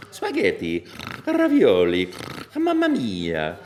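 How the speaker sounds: put-on Italian accent, loud on ravioli, slow mamma mia; Mario voice. Voice actor recalling Mario 64 lines in an Italian accent. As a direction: savour each Italian word playfully. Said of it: "Mario sixty-four".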